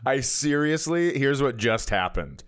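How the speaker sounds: ordinary talk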